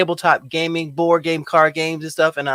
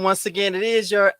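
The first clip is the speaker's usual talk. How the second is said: normal talking voice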